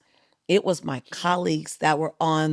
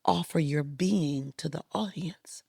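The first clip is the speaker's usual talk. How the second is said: hushed advice; 2 semitones lower, 6 dB quieter than usual. Retold or imitated. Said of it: "He goes".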